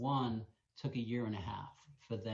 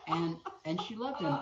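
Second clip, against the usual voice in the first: laughing through the words; giggling high while talking; real laughter breaking in; 10 semitones higher, 4 dB louder than usual. Real, not acted.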